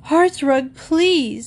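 high, pained interruption; exasperated plea. A narrator's reading. Wife cuts off husband's list of appliances, exasperated. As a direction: cut in high, begging them to stop.

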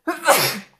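loud sharp sneeze; sudden sneeze. Real, not acted. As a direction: let the sneeze burst out. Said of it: "dat was hem".

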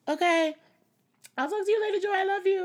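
very high, loud phone goodbye; bubbly friendly. Retold or imitated. Friend signing off a morning call, bubbly. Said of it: "very super friendly, bubbly voice".

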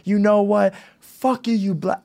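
high, hammed-up fury; imitating a woman snapping at the crowd; mock angry outburst. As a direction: mimic her blowing up. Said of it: "got to the point of".

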